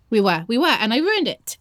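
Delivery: usual speaking voice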